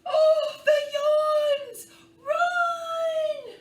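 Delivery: high, loud hammed-up shriek; playful panicked yell; mock panic shout. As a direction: scream it like running for your life. Characters flee the yawns.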